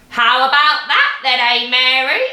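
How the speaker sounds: put-on loud cackly old-woman call from the roof; Grandma shouting. A narrator's reading. Grandma shouting down to Mary from the rooftop, gleeful. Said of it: "Grandma shouted".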